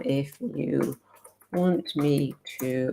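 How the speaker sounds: soft, slow words while typing; absent-minded typing aloud. Real, not acted. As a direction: murmur it slowly as you type. Typing a message while talking.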